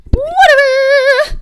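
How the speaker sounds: drawn-out whatever. Real, not acted. Drawn-out exclamation after gushing.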